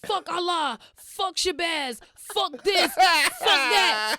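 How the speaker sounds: high, loud preachy ranting; hammed-up zealous ranting; mock cult rant. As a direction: rail against everything like a zealot. Mocking the cult's rants.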